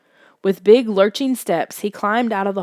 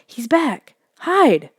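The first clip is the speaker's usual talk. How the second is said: urgent cry; 5 semitones higher, 4 dB louder, much slower than usual. A narrator's reading. Monster returning, urging hiding.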